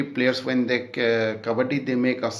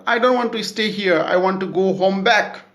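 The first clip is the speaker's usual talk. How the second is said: complaining; 6 semitones higher, 3 dB louder, faster than usual. Retold or imitated. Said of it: "complaining to his brother".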